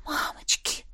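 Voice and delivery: breathless whispered gasp of fright; frightened whisper. A narrator's reading. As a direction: whisper it in sudden panic. Sudden fear in narration.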